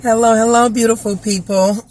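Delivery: ordinary delivery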